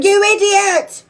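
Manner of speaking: high, loud, drawn-out screech; mock furious scream, stretched and high; imitated screaming mum. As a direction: screech it like a mum yelling upstairs. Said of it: "shouting and screaming".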